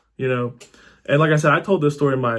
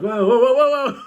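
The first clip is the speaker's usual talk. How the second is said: high, rising startled whoas; mock panic; 8 semitones higher, 3 dB louder than usual. Storyteller waking up in the water, mock panicked whoas. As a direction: retell the startled cry, pitched way up.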